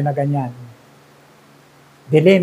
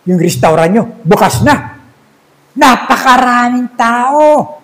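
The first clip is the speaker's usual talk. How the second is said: higher, eager retelling of the good news; excited news; 7 semitones higher, 4 dB louder than usual. Retold or imitated. Wife telling her husband the restaurant is open, excited. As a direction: burst in with the happy report.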